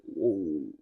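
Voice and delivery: low, soft drawn-out whoa; stunned whoa. Retold or imitated. Fired by the manager, low stunned whoa. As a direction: let the shock sink in low.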